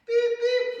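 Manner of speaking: high-pitched playful "beep, beep"; truck reversing beep. Pretend. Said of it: "I'm backing up".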